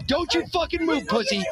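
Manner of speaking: mild mock yell while explaining the clip; retold yell, softened. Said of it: "He keeps yelling at him".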